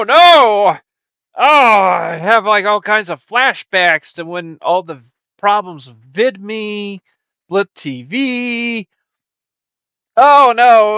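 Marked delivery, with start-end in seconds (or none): 0.0-0.8 panicked cry. Acted. Panic at bad news. 10.2-11.0 panicked cry. Acted. Panic at bad news.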